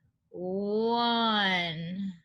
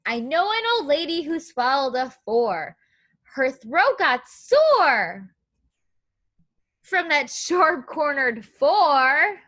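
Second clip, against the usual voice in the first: lilting, louder read-aloud rhyme; storybook reading; 3 semitones higher, 6 dB louder than usual. Acted. Teacher reading the rhyme to young children, sing-song. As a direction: read the rhyme like a picture book to kids.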